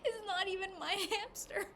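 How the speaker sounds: high, loud quote cracking with laughter; laughing and crying. Real, not acted. Joking to friend at the vet, laughing through tears. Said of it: "I'm laughing, crying".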